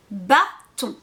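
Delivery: "bâton" en deux syllabes séparées, bâ puis ton; mot décomposé. Shown as a demonstration. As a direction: coupe "bâton" par une pause nette au milieu. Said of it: "décomposer comme je viens de le faire". (« bâton » scandé syllabe par syllabe)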